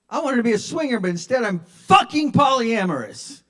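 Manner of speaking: high, loud exasperation; exasperated rant. Real, not acted. Would-be swinger lamenting his love life, exasperated. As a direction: complain at full comic outrage. Comic rant at own failure.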